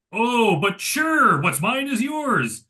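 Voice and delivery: high, slow, drawn-out agreeable voice; hammed-up, high-pitched compliance, words stretched out; mock obliging pushover. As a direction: imitate a man giving in far too easily. Voicing the compliant arms dealer in retelling.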